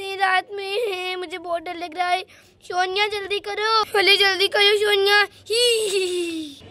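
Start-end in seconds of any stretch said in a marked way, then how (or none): 5.2-6.6 witch giggle. Acted. Witch laughing nearby.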